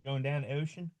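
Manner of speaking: put-on Baltimore accent question. Host repeating the line in a Baltimore accent, softly asking. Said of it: "has a hard Baltimore accent".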